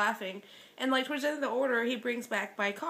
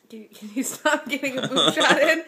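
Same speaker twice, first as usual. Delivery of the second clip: loud, laughing complaint; real laugh breaking into a loud objection; laughing protest; 9 dB louder than usual. Real, not acted. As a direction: object loudly through laughter. Protesting camera framing while laughing.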